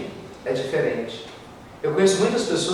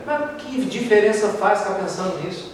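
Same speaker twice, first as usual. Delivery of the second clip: ordinary delivery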